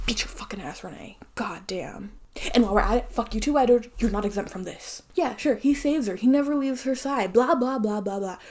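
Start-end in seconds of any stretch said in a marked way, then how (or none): 0.1-2.1 angry whisper. Real, not acted. Muttered threat to Renee.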